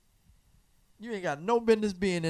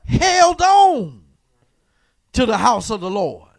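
louder and higher, rising preacher's call; preaching at full force; 7 semitones higher, 8 dB louder than usual. Acted. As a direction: drive it home to the congregation.

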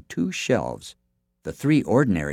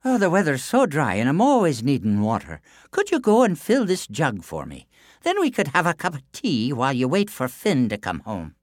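Una's voice; 6 semitones higher than usual. A narrator's reading. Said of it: "Then she said".

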